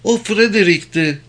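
high, broken sobbing cry. Acted. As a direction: choke it out through sobs. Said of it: "hıçkırıklar içinde katılarak". (hıçkırarak)

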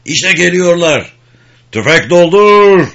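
high, loud, drawn-out order; shouted battle command. Acted. Sergeant warning the men as the enemy comes, shouting. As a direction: bellow it slowly to all the riflemen.

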